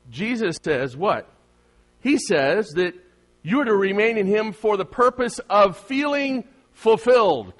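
high, emphatic preacher voice; impassioned pulpit delivery; forceful preaching. Acted. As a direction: preach it with rising force, leaning hard on key words.

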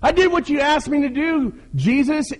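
very high, loud, hammed-up whine; whining complaint. Failed leader protesting to Jesus that nobody listens, whining loudly. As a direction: wail your grievance at the top of your range.

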